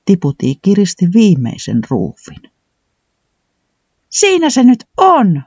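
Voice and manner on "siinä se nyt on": high, slow, delighted cry; joyful whoop. A narrator's reading. Tiputi finishing the plane's last screw, joyful whoop. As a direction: announce the finished plane with glee. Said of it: "Tiputi hihkaisi".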